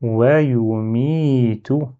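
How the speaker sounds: wayu, mi, tout joined as one word with a long "mi"; prolonged middle syllable. Shown as a demonstration. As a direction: recite it connected, sustaining the i of "mi". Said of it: "Et le mot en entier".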